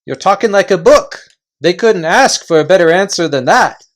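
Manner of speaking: loud, high chanting of agreement; eager chorus voices. Acted. Followers backing their chief, loud and eager. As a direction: cheer him on as a crowd of followers.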